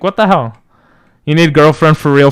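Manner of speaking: ordinary talk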